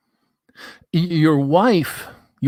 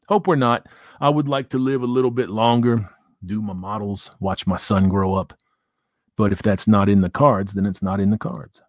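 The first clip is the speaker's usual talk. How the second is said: ordinary talk, nothing marked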